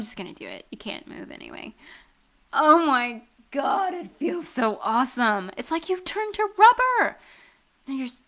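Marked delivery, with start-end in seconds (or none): 0.0-1.7 soft teasing whisper. Acted. Teasing aside while squeezing. 2.5-7.2 delighted squeal. Acted. Squeezing his rubbery buns.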